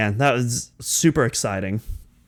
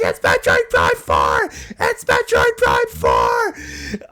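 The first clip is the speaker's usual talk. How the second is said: reenacted shouting; 6 semitones lower, slower than usual. Fan reliving reveal with friend, ecstatic shouts. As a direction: scream it again and again in joy. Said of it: "I was like".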